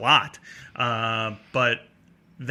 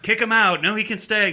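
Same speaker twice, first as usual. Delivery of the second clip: raised-pitch mock argument; battling; 7 semitones higher, 4 dB louder than usual. Two staff fighting over the fan's fate, mock battling. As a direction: act out two sides squabbling, punched and higher. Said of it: "they're kinda battling".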